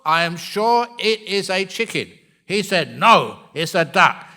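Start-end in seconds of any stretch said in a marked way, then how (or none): 0.0-2.1 insistent. Acted. Acted quarrel, wife insisting. 3.0-4.2 loud, firm contradiction; insistent. Acted. Husband contradicts his wife on their walk, loud and insistent.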